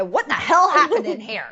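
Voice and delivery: a hint of a scolding Southern mom; imitating her mother. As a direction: play the exasperated mother asking what happened. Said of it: "I sound more and more like my mother".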